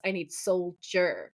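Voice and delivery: "soldier" drawn out slowly, syllable by syllable; slow right down to spell out the singular "soldier"; stress on soldier. Retold or imitated. Demon correcting Sam that he needs only one, "soldier" stretched out.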